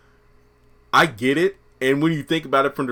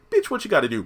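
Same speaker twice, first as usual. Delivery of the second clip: sharp comeback; 7 semitones higher, much faster than usual. Acted. Acted quarrel, [name] snaps.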